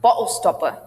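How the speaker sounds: BOTTLE STOPPER with a glottal stop for tt; "bo'le stopper", t dropped into the throat. Shown as a demonstration. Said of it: "a good one to practice".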